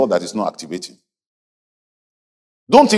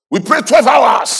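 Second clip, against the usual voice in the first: high, drawn-out bragging; mock boasting; 11 semitones higher, 4 dB louder, slower than usual. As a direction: imitate a proud youngster showing off to the pastor. Mimics young people boasting to pastor.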